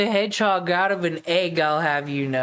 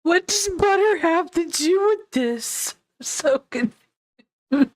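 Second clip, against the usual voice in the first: high confused voice; 11 semitones higher than usual. Acted. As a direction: play it dim and bewildered. Mocking confused character.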